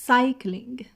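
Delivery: "cycling" with a loud, punched first syllable CY; exaggerated stress. Shown as a demonstration. Teacher exaggerating stress for students: loud CY in "cycling". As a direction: lean hard on CY in "cycling" for the class.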